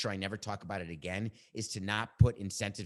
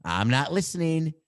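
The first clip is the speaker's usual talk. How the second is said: put-on high, loud, rising childish taunt; mock refusal to listen; 5 semitones higher, 5 dB louder than usual. Host tunes out producer in earpiece, stubborn rising chant. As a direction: drown him out, each time louder.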